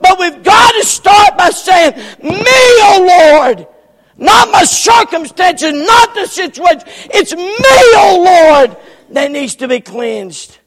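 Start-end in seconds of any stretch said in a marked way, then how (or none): 2.2-3.7 crying out slow and loud, voice breaking; loud tearful plea; pleading cry. Acted. Preacher voicing confession prayer. 7.1-8.8 crying out slow and loud, voice breaking; loud tearful plea; pleading cry. Acted. Repeats confession prayer.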